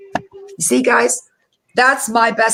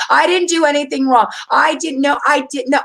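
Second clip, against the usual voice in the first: mocking whiny denial; 6 semitones higher than usual. Mocking people who never admit mistakes, whiny denial. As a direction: sneer it like a whiny excuse-maker. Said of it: "You don't pretend like".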